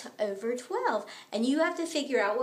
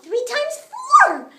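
much higher and louder, slow and triumphant; excited answer; 11 semitones higher, 12 dB louder, slower than usual. Acted. Baby shouting the answer out to the class. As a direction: announce it like a big discovery.

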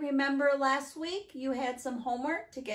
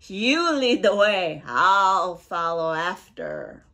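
loud monster voice, dropping low; amused Gruffalo; 5 semitones lower, 11 dB louder, slower than usual. A narrator's reading. Gruffalo humors boastful mouse, loud and amused. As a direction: humor the little boaster in a big creature voice. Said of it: "bursting with laughter".

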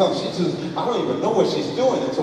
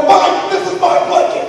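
loud, high, fast comeback; petty retold yelling; childish dad retort; 12 semitones higher, 8 dB louder, faster than usual. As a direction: shout back as petty as the child. Dad shouting back childishly.

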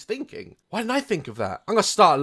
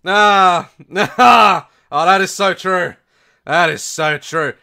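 fake laughing; 8 dB louder, slower than usual. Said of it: "they're like".

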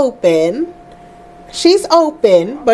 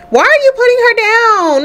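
surprised; 10 semitones higher than usual. Real, not acted. Sim sets the baby down.

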